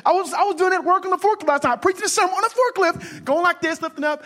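high, fast, fired-up preacher; preaching at full force. Acted. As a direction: preach it big to the crowd.

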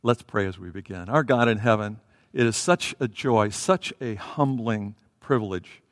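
usual speaking voice

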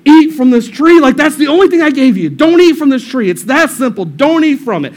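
loud, high exasperated telling-off; acted angry scolding. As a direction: scold like a fed-up parent, easing slightly. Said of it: "he gets angry".

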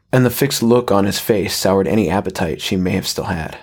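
ordinary delivery, nothing marked

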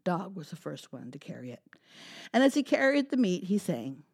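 put-on lively, animated read-aloud for kids; storytime narration. A narrator's reading. Librarian reading a story to children, animated. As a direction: tell it big and bright for little listeners.

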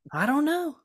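a light imitation of his confused self; bewildered shrug. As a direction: toss it off like you have no idea. His own retold bewildered reply.

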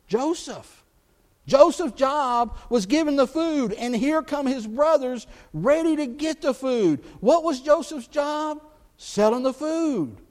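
rising sermon climax; preaching at full force. Real, not acted. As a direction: preach it out to the back row.

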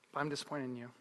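low, very soft letdown; grave parental disappointment. Retold or imitated. Parent to child after praise, quiet disappointment. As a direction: drop your voice in disappointment.

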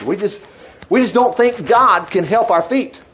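ordinary talk, nothing marked

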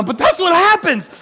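high, loud protest; emphatic exclamation. Real, not acted. Preacher defending the gory story to listeners, exclaiming.

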